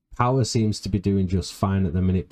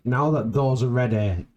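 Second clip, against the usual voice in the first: normal talking voice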